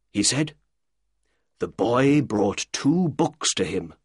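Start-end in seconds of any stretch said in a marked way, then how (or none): none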